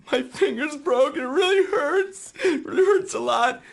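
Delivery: high, whiny mock crying; mock whining. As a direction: ham up a crybaby complaint. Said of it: "If I say anything right now".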